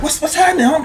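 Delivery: high, taunting greeting; mock friendly challenge. Confronting rivals at the mall, taunting high greeting. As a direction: greet them high with menace. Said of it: "we run up on 'em like".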